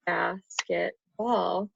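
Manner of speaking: basketball said slowly, syllable by syllable; counting syllables. Shown as a demonstration. Teacher counting syllables of basketball for her student. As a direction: stretch out basketball to count its beats. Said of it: "that's three syllables".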